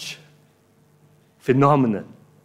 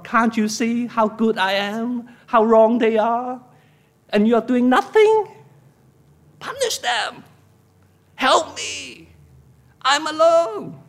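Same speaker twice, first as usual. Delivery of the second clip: high, whining complaint to God; very grumpy; 8 semitones higher than usual. Acted. Preacher mimicking believers who complain to God, very grumpy. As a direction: demand help in an aggrieved, pitched-up voice. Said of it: "Very grumpy".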